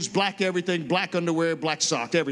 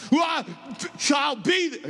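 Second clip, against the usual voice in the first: shouted retort; 8 semitones higher than usual. Retold or imitated. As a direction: fire the promise back hard. Gene answers the challenge.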